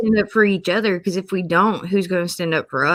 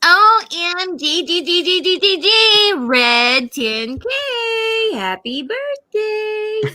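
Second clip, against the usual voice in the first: put-on high squealing girly voice; girl voice; 10 semitones higher, slower than usual. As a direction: read it like an excited teen girl. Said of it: "you wanna read this in, in a girl voice?".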